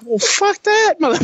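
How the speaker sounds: high-pitched mock yell of refusal; retold outburst. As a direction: blurt it back in a strained high shout. Answering back to the screamed orders.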